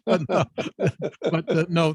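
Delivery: helpless chuckles breaking up the words; laughing. Real, not acted. Host trying to get back to invisible ETs, laughing. Laughing after co-guest's joke.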